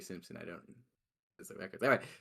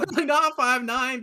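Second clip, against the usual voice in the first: laughing; 12 semitones higher, 9 dB louder, much slower than usual. Real, not acted. Laughing disbelief at friend's claim.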